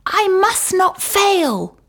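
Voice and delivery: high, firm tiny snail; determined snail voice. Acted. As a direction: vow it like a brave little snail. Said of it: "said the tiny snail".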